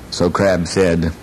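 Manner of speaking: usual speaking voice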